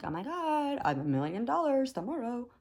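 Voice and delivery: high-pitched, hammed-up wishful gushing; mock excitement. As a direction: mimic a gushing believer in easy manifesting. Mocks naive believers in manifesting.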